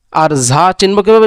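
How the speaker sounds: ordinary talk, nothing marked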